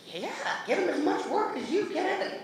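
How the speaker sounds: high-pitched, gleeful mum jumping at the offer; mock eager mother. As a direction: play an over-keen mother, voice way up. Delighted mother grabbing at offer.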